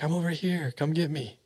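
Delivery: high, soft imitated faraway call. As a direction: imitate a lost woman's eerie call, softly. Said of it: "they hear her call out".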